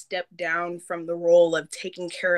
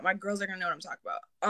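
softer, muttered repeat to herself; quiet aside; 9 dB quieter, much faster than usual. Real, not acted. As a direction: drop the volume and repeat quickly.

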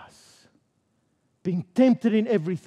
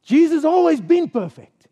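emphatic declaration; 5 semitones higher, 5 dB louder than usual. Real, not acted. Congregation answer echoed emphatically.

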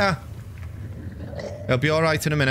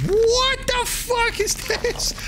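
shocked drawn-out cry; much slower than usual. Real, not acted. Finds gun in game.

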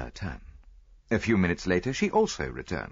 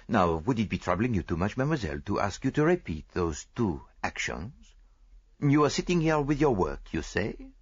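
lightly voiced foreign detective, patient; detective leading the maid through her story, patient; Poirot questioning; 3 semitones higher than usual. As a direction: ask her gently, step by step. Poirot asks for re-enactment.

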